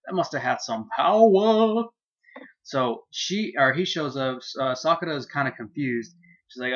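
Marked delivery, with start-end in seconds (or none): 0.1-1.8 high, drawn-out loud exclamation; hammed-up amazement. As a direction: stretch it out, pitched up, in exaggerated awe. Opens with an exaggerated reaction.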